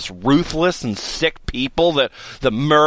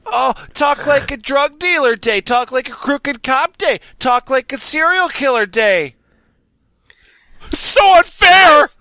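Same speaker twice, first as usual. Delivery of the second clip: rising indignant rant, building to a shout; hammed-up righteous fury; mock outrage; 7 semitones higher than usual.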